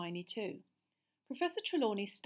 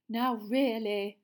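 slow, drawn-out, louder reproach; reproving seer; 3 semitones higher, 8 dB louder, much slower than usual. A narrator's reading. Teacher reproving laughing boys, slow and drawn out. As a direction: tut at them like an offended mystic. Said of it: "said Professor Trelawney".

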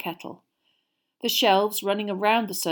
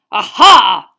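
loud knightly yell; 18 dB louder than usual. A narrator's reading. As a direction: bellow it out. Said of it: "he yelled".

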